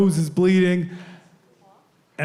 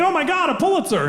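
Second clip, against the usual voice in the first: excited photographer; 12 semitones higher, faster than usual. Retold or imitated. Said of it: "And he's like".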